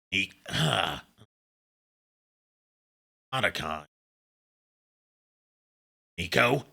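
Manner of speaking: quiet, low-key Snake-style call. Pretend. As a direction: call the name softly like Snake. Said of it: "Say Nico in Snake's voice".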